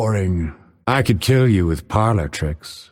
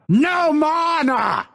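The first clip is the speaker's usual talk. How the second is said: high, drawn-out shout; frustrated yell; slower than usual. Acted. Fighter out of mana, frustrated yell. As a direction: yell it out in exasperation.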